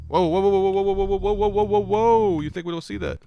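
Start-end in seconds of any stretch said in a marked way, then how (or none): none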